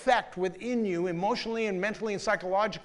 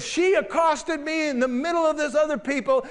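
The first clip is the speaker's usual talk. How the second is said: high, louder, flustered complaining man; upset boss; 7 semitones higher, 4 dB louder than usual. Retold or imitated. Embarrassed director phones the pastor, high and flustered. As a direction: play him rattled and indignant. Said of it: "He's all upset".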